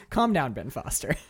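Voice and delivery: ordinary talk, nothing marked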